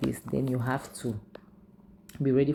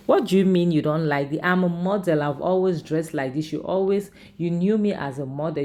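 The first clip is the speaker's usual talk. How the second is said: louder retold protest, insistent; indignant protest; 4 semitones higher, 5 dB louder than usual. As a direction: push back at him, voice raised. Acted indignant wife protesting to husband.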